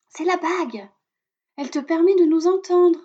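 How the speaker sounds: high, bright bird voice; little robin voice. A narrator's reading. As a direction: voice a tiny cheerful robin. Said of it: "lui répond Musica".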